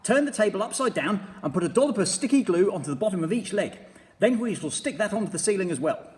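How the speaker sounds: high, loud, eager commanding creature; a bossy little beast giving orders; Mugglewump voice. A narrator's reading. Mugglewump directing the birds, loud and high.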